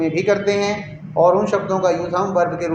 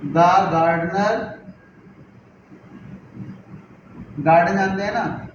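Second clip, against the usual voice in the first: word by word with long pauses; teacher spacing out words for the class; slow dictation; slower than usual. Shown as a demonstration. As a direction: dictate each word for writing. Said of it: "sentence number five है".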